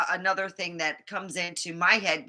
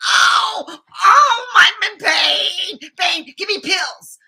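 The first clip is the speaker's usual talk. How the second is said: hammed-up moaning much higher and louder; mock pill seeker; 10 semitones higher, 10 dB louder, slower than usual. Mocking the patient she refused to be, loud whiny wail. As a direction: wail like a drama-queen patient begging pills. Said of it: "didn't walk around going".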